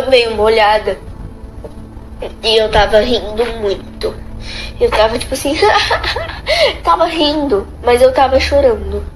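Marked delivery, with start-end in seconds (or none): 5.6-6.8 happy laugh. Real, not acted. Retelling laughing and crying together.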